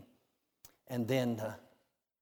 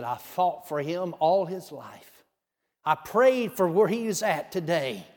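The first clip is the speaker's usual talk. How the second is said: higher, louder, slow rising protest; angry mother; 6 semitones higher, 8 dB louder, slower than usual. Retold or imitated. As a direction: push back hard, defending her baby. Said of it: "She was angry".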